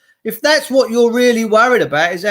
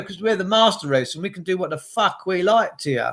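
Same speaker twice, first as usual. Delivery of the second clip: usual speaking voice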